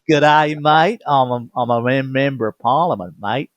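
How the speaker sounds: mock Australian accent. Joking to friends, a hammed-up mock Australian accent. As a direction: send up an Aussie bloke with a big Australian twang. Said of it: "like the Australians say".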